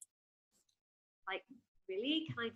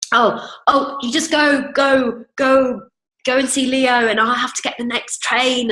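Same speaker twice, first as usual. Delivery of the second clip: loud and hurried, shooing him off; loud frazzled urging; flustered panic; 2 semitones higher, 19 dB louder, slower than usual. Retold or imitated. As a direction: urge him to go while you panic. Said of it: "Cause I couldn't find it".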